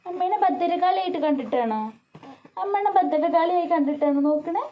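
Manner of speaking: playful teasing. Real, not acted.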